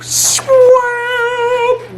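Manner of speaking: high strangled cry on swamp; pained yelp. Real, not acted. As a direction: yelp from the spice heat. Reacting to spicy bite.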